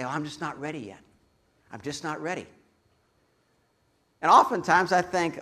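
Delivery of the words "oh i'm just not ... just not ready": soft, hesitant voice of someone putting off God; reluctant seeker. Retold or imitated. Seeker putting off the preacher, in a soft reluctant voice. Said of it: "They say".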